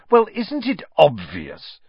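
high, loud, drawn-out know-all scorn; superior. A narrator's reading. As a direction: make it slow and maddeningly superior. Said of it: "with a look of maddening superiority".

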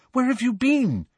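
high-pitched, anxious question; worried relief at seeing a friend. A narrator's reading. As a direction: ask with worry, pitch jumping up. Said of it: "said Hermione anxiously".

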